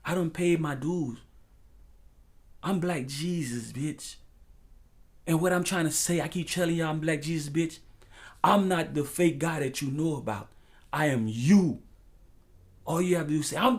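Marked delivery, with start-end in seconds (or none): none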